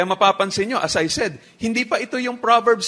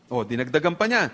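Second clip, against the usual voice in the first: ordinary talk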